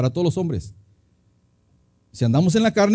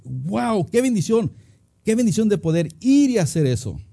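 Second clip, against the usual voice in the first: higher-pitched, acted wonder; grateful enthusiasm; 5 semitones higher than usual. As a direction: marvel at the privilege, lifting the pitch. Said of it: "Totalmente diferente". (con entusiasmo agradecido, voz más aguda)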